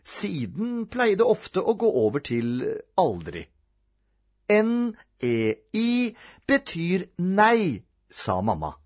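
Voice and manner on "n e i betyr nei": slow, higher, spelled-out refusal; firm mother. A narrator's reading. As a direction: spell it out slowly and firmly.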